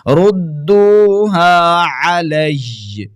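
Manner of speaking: slow, drawn-out melodic tilawat; Quran recitation. Acted. Recites the correct stop. (ترتیل کے ساتھ تلاوت)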